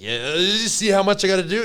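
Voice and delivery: a hammed-up stressed-out complaint; overwhelmed plea. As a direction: plead like the to-do list is crushing you. Stressed plea to his partner.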